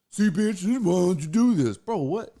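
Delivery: brash imitation of a crude friend; crude buddy. Buddy bossing his girl, a lightly brash mock voice. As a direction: ham up the clueless buddy bossing her. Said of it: "my buddy's old stupid ass".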